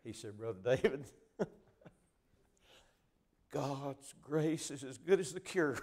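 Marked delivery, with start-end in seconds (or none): 0.4-1.5 laughing. Retold or imitated. Dying friend telling the preacher, laughing with joy. Dying man's last joyful words. 3.5-5.8 slow, louder, laughter through "God's grace is as good as the cure"; laughing. Retold or imitated. Dying friend affirming the preacher's words, laughing.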